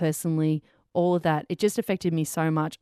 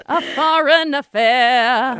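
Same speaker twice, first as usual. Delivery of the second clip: drawn-out innuendo; 7 semitones higher, 8 dB louder, much slower than usual. Acted. Drawn-out pun.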